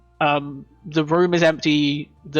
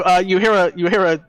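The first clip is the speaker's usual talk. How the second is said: ordinary delivery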